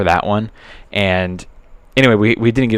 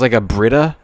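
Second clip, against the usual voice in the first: normal talking voice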